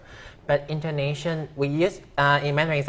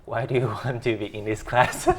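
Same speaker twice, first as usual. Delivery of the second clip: asked through a laugh. Retold or imitated. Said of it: "I would ask them".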